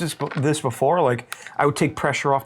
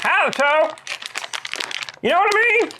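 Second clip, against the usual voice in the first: much higher, comic squeaky voice; high put-on voice; 11 semitones higher than usual. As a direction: list it all in a silly high voice. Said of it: "that is your voice".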